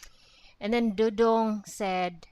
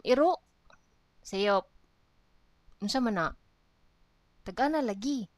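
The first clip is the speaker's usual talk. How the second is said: acted dialogue, a bit higher and livelier; lively dialogue reading; 4 semitones higher, faster than usual. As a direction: read the little scene as two playful friends. Replays dialogue as characters.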